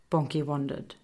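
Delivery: ordinary delivery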